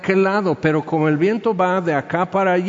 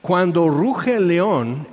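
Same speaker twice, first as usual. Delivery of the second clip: ordinary talk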